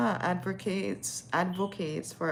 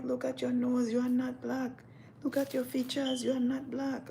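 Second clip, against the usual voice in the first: high, insistent scolding; imitated African lady; 7 semitones higher than usual. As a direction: scold in a high, measured put-on voice. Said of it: "She's like".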